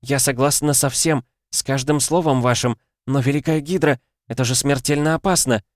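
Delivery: high-pitched, anxious woman; worried, pleading protest; panicky pleading. A narrator's reading. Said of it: "В женском голосе теперь ощутимо слышалась паника".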